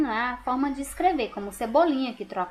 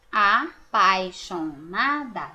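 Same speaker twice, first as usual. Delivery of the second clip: slow, "apaixonada" broken into syllables; 4 semitones lower, much slower than usual. Shown as a demonstration. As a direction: separate "apaixonada" into syllables slowly. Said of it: "Do jeitinho que a gente fala".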